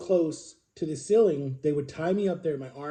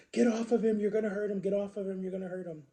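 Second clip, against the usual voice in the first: higher, urgent imitation of a screaming sister; mock screaming; 5 semitones higher than usual. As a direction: imitate her frantic screams without full volume. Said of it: "my sister's screaming, like".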